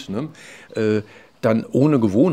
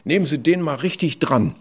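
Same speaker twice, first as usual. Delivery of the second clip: lightly voiced parent or teacher, raised and insistent; imitated demanding adults; 4 semitones higher, 5 dB louder than usual. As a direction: quote a complaining parent, a bit louder and higher. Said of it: "hörte ich immer wieder von Eltern, von Lehrern". (leicht nachgeahmte fordernde Eltern oder Lehrer)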